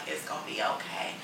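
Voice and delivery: soft, low comforting words; soothing. Retold or imitated. Friend comforting her over the dog, softly. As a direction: say it low and gentle like rubbing a back. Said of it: "She's like".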